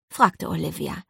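ordinary delivery, nothing marked

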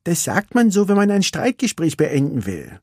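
put-on high, bored young racer; Vanellope's voice. Vanellope tells Ralf she is bored, wistful.

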